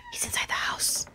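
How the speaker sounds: gasp, then whisper it in real fright; breathless, scared whisper as the killer gets in; frightened whisper. Real, not acted. Jump scare in horror game.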